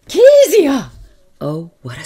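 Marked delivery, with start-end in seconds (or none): 0.1-0.9 sudden loud, high call; sharp shout. A narrator's reading. Aunt Beryl catches Kezia, shouting sharply. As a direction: shout the name to startle. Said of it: "It was Aunt Beryll's voice".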